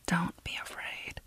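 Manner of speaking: barely voiced self-reassurance; girl whispering courage to herself; whispered to self. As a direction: breathe it to yourself, steadying. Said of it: "she told herself".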